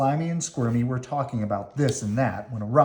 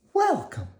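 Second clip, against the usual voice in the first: very high welcoming worm voice; Squirmy welcoming. Acted. Squirmy welcomes Slimy home, very high and bright. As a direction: sing out a welcome.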